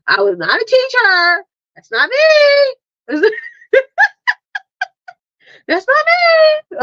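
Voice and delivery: high, drawn-out squealing denial; mock protest. Mom playfully voicing Diane's denial, high and slow. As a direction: wail it out, playing Diane. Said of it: "She's like".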